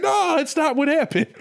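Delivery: high-pitched mock protest; hammed-up panicky denial; protesting Adam. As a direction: plead innocence in a comically high voice. Acted Adam protesting Eve's accusation.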